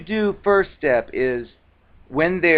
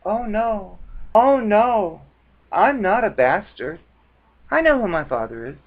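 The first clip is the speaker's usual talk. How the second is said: mock innocent; 6 semitones higher than usual. Shown as a demonstration. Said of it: "and just like".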